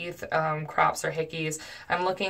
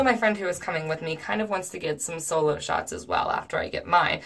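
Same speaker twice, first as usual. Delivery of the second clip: plain reading voice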